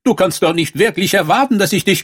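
high-pitched, indignant refusal; haughty princess. A narrator's reading. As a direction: brush the frog off as a princess. (hohe, hochnäsige Prinzessinnenstimme)

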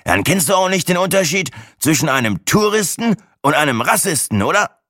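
high, put-on show-off voice; braggart setting up joke. A narrator's reading. Show-off springing a joke on his companion, cocky. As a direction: lead eagerly toward your own punchline.

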